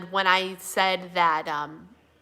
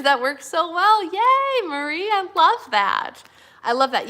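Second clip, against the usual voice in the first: delighted gushing; 8 semitones higher, 8 dB louder, slower than usual. Real, not acted. Reading comment with delight.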